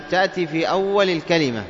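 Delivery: ordinary talk, nothing marked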